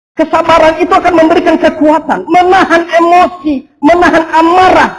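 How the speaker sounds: loud, high, driving sermon voice; fervent preaching. Acted. Pastor urging the congregation, loud fervent preaching. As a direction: preach it out to the whole church.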